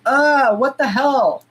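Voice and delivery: mock baffled self; confused self. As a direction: act out her stuck brain. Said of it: "where I'm like".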